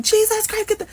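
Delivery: put-on very high-pitched Trini-accented scream; imitated screaming. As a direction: mimic an urgent cry of alarm. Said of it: "And I hear screaming".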